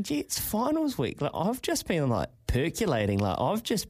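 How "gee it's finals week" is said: excited inner thought. Retold or imitated. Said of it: "I was thinking".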